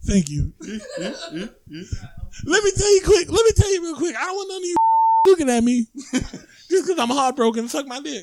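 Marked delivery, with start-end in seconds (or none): 2.6-7.7 high-pitched lisping boxer voice; Mike Tyson. Pretend. Comedian mock-warning those looking at him, high boxer impression. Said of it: "like, Mike Tyson". 7.7-8.2 high, softer boxer impression; Mike Tyson. Comedian ending his mock rant, softer high boxer impression. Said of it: "like, Mike Tyson".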